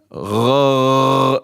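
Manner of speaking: "Gha" from the back of the throat like gargling; gargled Gha. Shown as a demonstration. Teacher shows the Ghain sound, gargling like water. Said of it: "when you gargle in water?".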